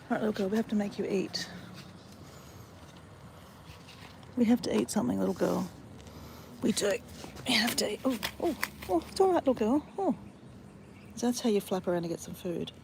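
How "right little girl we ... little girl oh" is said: high, sing-song coaxing of a pet bird; pet talk. Real, not acted. Owner coaxing her injured bird to eat, high baby talk.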